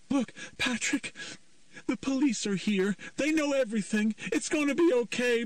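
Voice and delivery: high, pleading mother voice; desperate mother. A narrator's reading. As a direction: beg him gently to put the gun down. Nancy pleading with her son.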